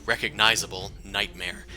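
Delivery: normal talking voice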